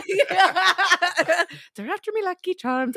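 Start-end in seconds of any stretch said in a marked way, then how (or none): 1.8-3.0 higher cartoon Irish, laughing through it; Irish accent. Explaining her Irish reset to friends, laughing cartoon Irish. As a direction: leprechaun Irish. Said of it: "That's the Irish reset".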